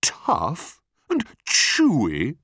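high, slow, drawn-out outrage; scandalised crocodile echoing an insult; incredulous cry. A narrator's reading. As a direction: throw it back in disbelief. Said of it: "cried the enormous crocodile".

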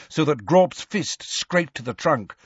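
usual speaking voice

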